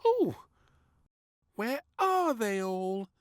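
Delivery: high, slow wondering; high, slow, curious girl; wondering little princess. A narrator's reading. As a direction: look around puzzled. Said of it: "she wondered".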